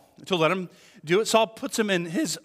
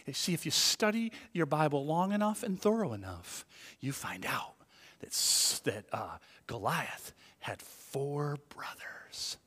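dramatic revelation; 3 semitones lower, 9 dB quieter than usual. Retold or imitated. Podcast pastor revealing Goliath's brothers, dramatic hush to whisper. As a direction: stretch out the big secret, hammed up. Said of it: "all dramatic".

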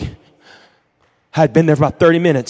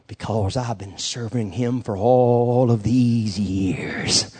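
dying Joshua; 3 semitones lower than usual. Acted. Dying leader telling his family of lifelong service, low solemn voice. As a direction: speak deep and weighty, as last words from a deathbed. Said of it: "He was dying that day".

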